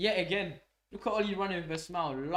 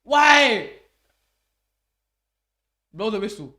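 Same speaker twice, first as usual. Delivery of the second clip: shocked yell; 5 semitones higher, 16 dB louder than usual. Real, not acted. Opponent fans livid.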